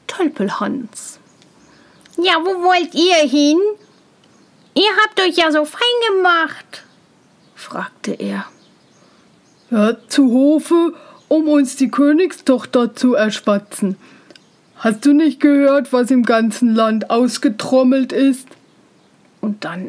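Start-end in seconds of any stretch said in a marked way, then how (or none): 2.2-6.8 higher, curious simpleton voice; naive younger brother. A narrator's reading. Narrator voices curious Tölpelhans. 9.7-18.5 self-important character voice, firmly projected; haughty older brothers. A narrator's reading.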